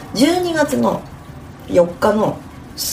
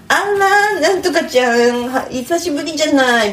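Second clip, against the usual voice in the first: higher, louder sugary hostess greeting; old-style snack mama; 6 semitones higher, 4 dB louder than usual. Retold or imitated. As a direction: greet a regular like a mama-san. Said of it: "みたいなやつは".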